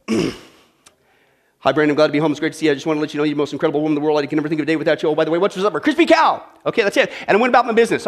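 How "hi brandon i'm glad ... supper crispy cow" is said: rushed marathon greeting. Pretend. Pastor rushing a dutiful speech to his wife. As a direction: rattle off fast, lower, in one breath. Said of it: "I come home".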